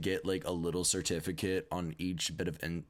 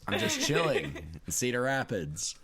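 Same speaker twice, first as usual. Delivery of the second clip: laughing; 4 semitones higher, 4 dB louder than usual. Pretend. Repeating the mock quote.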